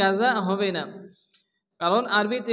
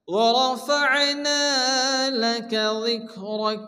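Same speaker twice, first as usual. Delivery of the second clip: slow, drawn-out melodic recitation; Quran recitation; much slower than usual. Shown as a demonstration. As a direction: stretch each word in a tuneful chant. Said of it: "reciting the verse with tajweed". (ধীর টেনে টেনে সুরেলা তিলাওয়াত)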